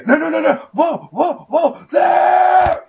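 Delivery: high, loud panicked yelling; hamming up a movie action moment; mock frantic shout. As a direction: yell like a hero in a fight. Mock action scene outburst.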